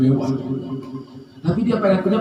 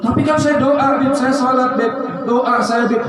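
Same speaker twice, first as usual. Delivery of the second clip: protesting congregant; 7 semitones higher than usual. Acted. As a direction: insist you really do pray.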